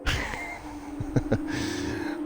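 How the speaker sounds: high unvoiced burst of laughter; breathy laugh. Real, not acted. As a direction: laugh off the mess. Reacting to absurd race.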